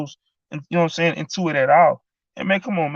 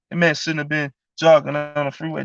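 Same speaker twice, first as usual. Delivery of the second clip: ordinary delivery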